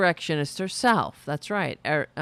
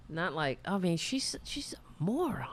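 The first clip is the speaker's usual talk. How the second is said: unvoiced whispered jab, much softer; whispered aside; 3 semitones lower, 11 dB quieter than usual. Real, not acted. Host whispers insult to viewers, hushed whisper. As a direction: drop to a secret whisper for the insult. Snide aside about AOC's opponent.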